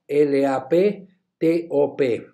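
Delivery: slow letter by letter spelling; teacher spelling for students. Shown as a demonstration. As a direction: spell laptop slowly, one letter at a time. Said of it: "spells the word".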